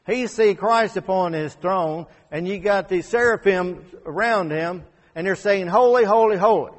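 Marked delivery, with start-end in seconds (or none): 5.7-6.7 loud, high ringing proclamation of holy; booming holy. Retold or imitated. Seraphim praising Christ on his throne, loud ringing cry.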